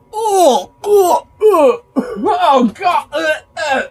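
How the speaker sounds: high laughing squeals; laughing cry. Real, not acted. Reacting to bong hit.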